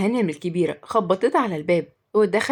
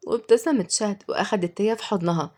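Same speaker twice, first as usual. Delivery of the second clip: ordinary delivery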